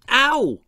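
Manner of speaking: high, loud yelp of pain; sharp yelp. A narrator's reading. Dee yelping when the pickaxe hits her nose. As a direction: yelp as if jabbed inside. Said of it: "yelped Dee".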